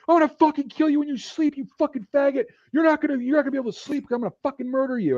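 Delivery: high-pitched strained mock rage; furious threats. Sleep-deprived soldiers to alarm puller, furious threatening. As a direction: imitate livid bunkmates making threats. Woken men threaten him.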